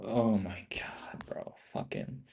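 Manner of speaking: slow, breathless whisper; hushed exasperated whisper; stunned whisper. Real, not acted. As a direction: whisper it in disbelief.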